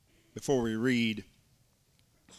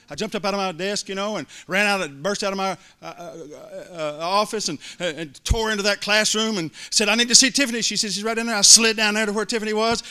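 higher, louder storytelling as he runs in; excited rush; 6 semitones higher, 6 dB louder than usual. Real, not acted. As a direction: carry the thrill forward. Excited retelling of running.

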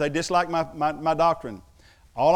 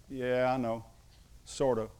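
low, soft, unconvinced retort; understated, deflating aside; dry reply; 3 semitones lower, 5 dB quieter than usual. Retold or imitated. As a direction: answer the eager student with quiet doubt. Dry reply to eager student.

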